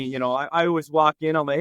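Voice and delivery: ordinary talk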